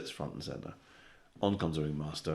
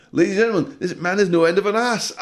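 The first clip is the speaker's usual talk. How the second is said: much higher and louder, hammed-up clown; shrieking clown; 14 dB louder than usual. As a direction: screech it like a manic clown. Clown mocking, second time.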